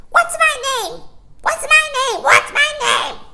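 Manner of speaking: very high, loud pleading bird cry; shrill and worried; shrill anxious starling. A narrator's reading. Starling begging baby John to remember him, shrill and anxious. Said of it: "in a shrill, anxious voice".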